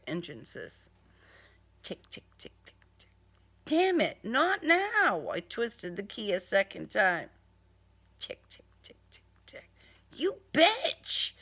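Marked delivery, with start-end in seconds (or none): 1.8-3.1 faint whispered engine ticks; whispered ticking. Acted. Engine failing to start. 3.7-5.3 frustrated outburst. Acted. Frustrated at dead engine. 8.2-9.7 faint whispered engine ticks; whispered ticking. Acted. Engine failing again. 10.1-11.3 much higher, sharp angry yell; angry curse. Acted. Cursing the car.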